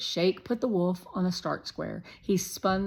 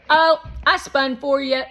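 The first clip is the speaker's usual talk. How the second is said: put-on high, loud pushy boy; Shake voice; 8 semitones higher, 12 dB louder than usual. A narrator's reading. Narrator voicing Shake, a loud, high pushy boy. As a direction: play the bossy guest kid, loud and high.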